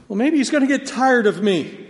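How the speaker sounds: high, loud anxious believer's voice; doubting believer. Retold or imitated.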